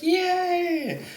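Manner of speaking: high squealed yay; shrill party cheer; excited cheer. Retold or imitated. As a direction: squeal it out with joy. Cheering amid new friends at concert.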